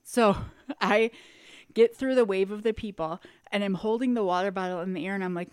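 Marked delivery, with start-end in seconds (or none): none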